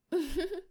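short closed-mouth giggle; teasing giggle. Acted. Teasing surprise for partner.

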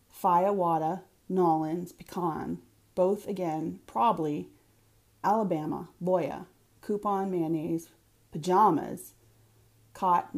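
blue-collar Boston accent, r's dropped; old hometown Boston vowels, "theater" ending in "-ah". Shown as a demonstration. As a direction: say the word list in a native Boston accent. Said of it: "in Boston, or if I were drinking with my siblings".

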